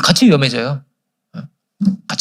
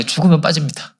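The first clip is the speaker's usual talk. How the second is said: normal talking voice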